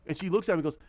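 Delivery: ordinary delivery, nothing marked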